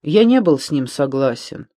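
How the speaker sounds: ordinary delivery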